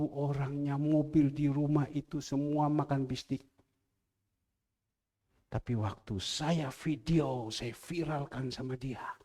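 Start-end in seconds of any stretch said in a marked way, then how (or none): none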